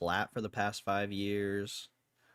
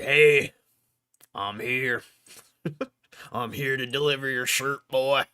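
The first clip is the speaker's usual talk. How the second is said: a hint of a Southern accent, hammed; 3 semitones higher, 9 dB louder than usual. Streamer imitating the CEO at his door, light Southern accent. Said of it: "Ryan has a southern accent".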